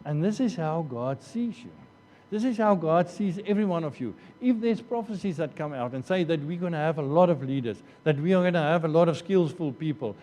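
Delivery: ordinary talk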